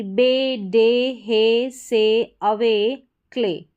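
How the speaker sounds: slow list with the ay drawn out; long AY sound. Shown as a demonstration. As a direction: stretch the ay ending in "बे, डे, हे, से, अवे, क्ले". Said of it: "एवाई का उच्चारण ए करके".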